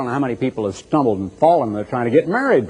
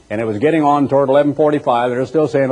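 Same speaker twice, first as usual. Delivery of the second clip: normal talking voice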